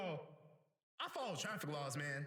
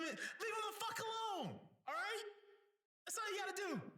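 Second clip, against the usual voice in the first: raised, high-pitched angry outburst; angry rant; 6 semitones higher than usual. Real, not acted. Driver ranting at impatient people on the road, angry. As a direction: let the exasperation climb into a high yell. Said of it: "my first rant".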